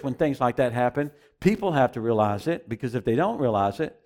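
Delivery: normal talking voice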